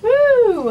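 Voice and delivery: loud, high "woo!"; excited whoop. Real, not acted. Guest revved up to perform on radio, excited whoop. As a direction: let out a high, ringing whoop. Guest hyping herself up on air.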